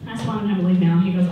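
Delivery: ordinary talk, nothing marked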